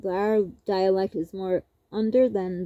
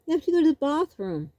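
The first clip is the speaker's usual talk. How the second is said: "bathroom" with an open, broad Canadian a; imitating cousin's Canadian "bathroom", "pɑθɹũm"; mock Canadian accent on "bathroom"; 6 semitones higher, faster than usual. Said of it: "purposely made it a little more bathroomy".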